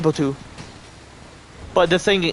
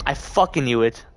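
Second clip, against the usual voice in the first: normal talking voice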